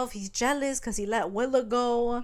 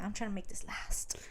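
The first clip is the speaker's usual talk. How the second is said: whispered; 7 dB quieter than usual. Retold or imitated. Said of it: "He's like".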